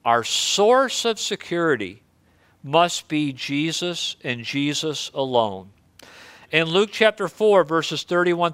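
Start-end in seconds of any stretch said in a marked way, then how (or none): none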